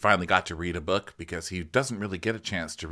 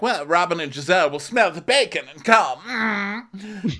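higher, put-on Karen voice with a hum; mock Karen; 9 semitones higher than usual. Recap host mimicking Karen at breakfast, mock prim. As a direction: play up a housewife's prim remark. Acted catty quote of Karen.

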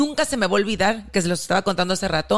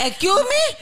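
high-pitched, startled "Excuse me"; shocked excuse me; 13 semitones higher, much slower than usual. Retold or imitated. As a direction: squeak it out in disbelief. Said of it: "Le dije". (un "Excuse me" agudo de sorpresa)

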